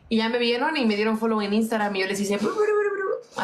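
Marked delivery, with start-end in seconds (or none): 2.4-3.4 higher raspberry noises, bru bru; mock them with a silly lip trill; blowing raspberries. A playful raspberry at the trolls who followed her. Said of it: "Así les hice".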